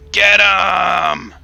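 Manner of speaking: loud, high yell of "Get 'em!"; rally the charge with a shout; dwarf yelling a charge, no Scottish accent attempted; shouted battle cry. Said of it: "there is a brogue".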